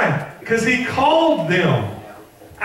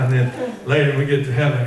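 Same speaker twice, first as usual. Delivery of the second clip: ordinary talk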